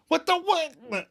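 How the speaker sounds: high shocked squeak. Retold or imitated. Ross, soaked with a drink, shocked squeak. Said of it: "Ross, shocked, goes".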